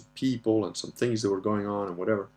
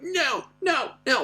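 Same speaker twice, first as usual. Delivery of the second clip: put-on stubborn little kid voice, pitched way up; mock toddler protest; defiant toddler; slower than usual. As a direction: imitate a small boy's repeated high refusals. Retold son refusing to apologize.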